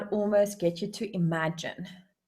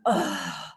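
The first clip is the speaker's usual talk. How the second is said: low, drawn-out groan; reluctant groan; 10 semitones lower than usual. Acted. Owner dreading weekend work, reluctant groan.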